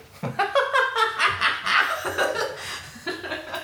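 high, hooting cackle; old woman's cackle. Acted. Mentor amused by her pupil, old woman's cackle. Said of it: "An old woman's voice".

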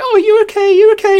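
high, loud fretting voice; worried girlfriend. Retold or imitated. Girlfriend fussing after he was headbutted. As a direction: fuss over him anxiously.

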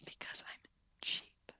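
slow hushed whisper; drawn-out ASMR whisper. Pretend. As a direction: whisper it as a sheepish confession. Laughing, whispering in ASMR bit.

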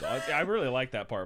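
normal talking voice

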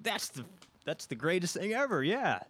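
imitating gushing, high-pitched admirers; mock amazement. Florida people reacting to Midwestern work ethic, mock amazement.

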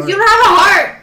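high, loud emotional retort; loud accusation. Real, not acted. Friend accusing the one who rarely cries, loud retort. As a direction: fling it out loudly, pitch jumping.

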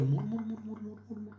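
high muttered murmur; mumbling prompter. Acted. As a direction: mumble unintelligibly.

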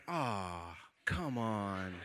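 low, soft deflated groan; quiet dismay. Retold or imitated. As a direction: sigh it out low, heart sinking. Inner dismay facing angry stranger.

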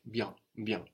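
bien with a nasal vowel and no final n; French bien. Shown as a demonstration. Teacher correcting the student's bien. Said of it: "so it sounds like".

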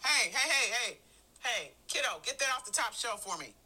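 brusque at-home voice. Acted. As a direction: order a child about at home. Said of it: "I may sound something like this".

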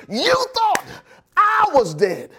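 high, stretched pulpit shout; shouted triumph from the pulpit; defiant preaching shout. Real, not acted. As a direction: throw it defiantly at the doubters. Preacher repeats his climactic line.

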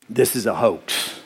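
low, unimpressed retold aside; deadpan skepticism. Telling a friend he saw right through it, deadpan. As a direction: drop the pitch and say it dryly.

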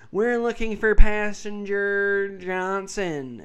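slow gate announcement voice; over the intercom. Retold or imitated. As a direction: announce it like airport staff. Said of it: "you know how they go".

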